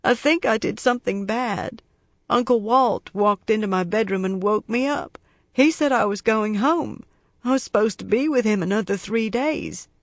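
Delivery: put-on a worried little boy explaining himself; young boy voice. A narrator's reading. Boy tells Scarpetta why he flew home, anxious.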